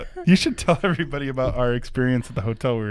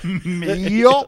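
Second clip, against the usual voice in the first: mock Southern accent; 7 semitones higher, 5 dB louder than usual. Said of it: "I'm gonna get me some milk".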